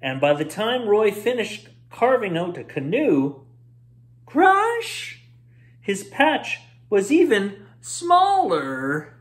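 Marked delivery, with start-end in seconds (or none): 4.2-5.2 high loud sound-effect crash. A narrator's reading. Narrator shouting the crash sound word. 5.8-9.1 slow, higher, leaning on smaller; dramatic reveal. A narrator's reading. Narrator revealing the patch shrank further, slow.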